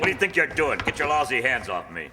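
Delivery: loud, high, indignant outburst; angry protest. Acted. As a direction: bark at him to get his hands off. Undercover cop frisked, protests angrily.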